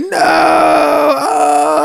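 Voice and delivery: long, high mock wail; hammed-up scream of dread; horrified scream. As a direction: cry out in drawn-out comic terror. Acts out Larry's horrified cry in car.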